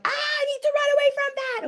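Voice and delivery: very high, fast, alarmed yelp; mock fright. Berry picker flees a charging boar, in a hammed-up, very high frightened yelp. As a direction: squeal it like a boar is charging you.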